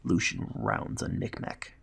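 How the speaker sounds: ordinary delivery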